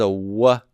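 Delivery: "W" as the sound "wuh"; earlier Hebrew W. Shown as a demonstration. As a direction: say "wuh" with rounded lips for "W". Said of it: "would have been pronounced as a W sound".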